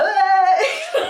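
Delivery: high, loud drawn-out cheer; whooping cheer. Retold or imitated. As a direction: whoop it out like a rowdy crowd. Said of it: "Scots are in my comments being like".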